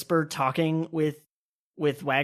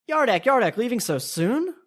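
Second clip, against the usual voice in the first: high-pitched call to a departing guest; raised, sing-out greeting; 8 semitones higher than usual. Acted. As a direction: hail them across the room, voice lifted. Host calls to departing guest.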